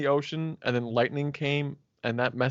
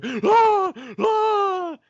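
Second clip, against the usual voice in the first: high, loud far-off cry imitating Zapdos; mock screaming Pokemon; 6 dB louder than usual. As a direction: screech like a bird yelling at the sky. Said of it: "if he just sat here like".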